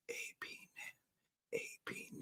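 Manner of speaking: hushed whisper, barely audible. Pretend. Host getting ready for class, whispering. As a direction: whisper the class name like a private chant.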